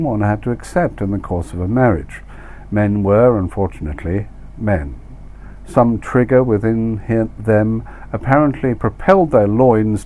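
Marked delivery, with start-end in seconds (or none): none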